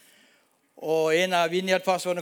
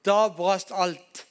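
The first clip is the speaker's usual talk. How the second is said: slow, moved; much slower than usual. Real, not acted. Climax of the testimony.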